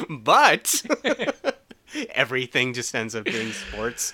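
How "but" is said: high-pitched burst of laughter. Real, not acted.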